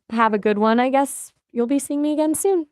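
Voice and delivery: ordinary talk, nothing marked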